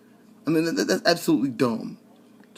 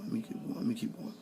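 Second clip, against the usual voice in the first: whispered to himself; 3 semitones lower, 16 dB quieter, faster than usual. Shown as a demonstration. Muttering to himself to move on, whispered. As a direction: let me keep going, breathed out. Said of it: "repeats, fading to whisper".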